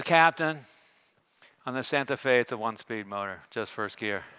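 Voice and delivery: low, quiet deadpan reply; flattest tone. Retold or imitated. Officer correcting his captain about the motor, flattest tone. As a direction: answer in a toneless, subdued voice, dropping away. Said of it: "it was like this flattest tone".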